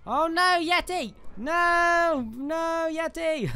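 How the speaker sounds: high-pitched, playful cry of dismay; panicked wail. Real, not acted. Player pleading as the Yeti attacks, wailing high.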